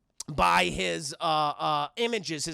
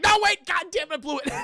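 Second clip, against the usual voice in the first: higher, louder burst of mock panic; mock panicked Peter; 9 semitones higher, 4 dB louder than usual. Peter realizing he missed his chance, mock panic. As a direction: yelp it like you just blew your chance. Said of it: "Peter's like".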